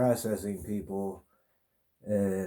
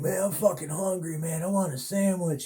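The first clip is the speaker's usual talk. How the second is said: put-on higher, whiny hungry drunk; drunk guy; 8 semitones higher than usual. Drunk inmate in the holding cell whines to everyone, higher and whiny. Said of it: "he's sitting there like".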